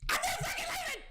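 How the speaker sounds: very high, screamed movie quote; Leonardo DiCaprio. Acted. Streamer quotes a movie star, screaming defiance. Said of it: "just like Leonardo DiCaprio".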